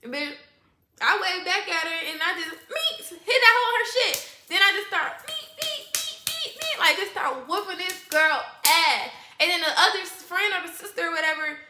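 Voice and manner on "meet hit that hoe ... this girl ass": high squeaky "meet, meet" hit noises; punching sound effects. Retold or imitated. Retelling the fight to the audience with high punch noises. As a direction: make the whack sounds of each punch.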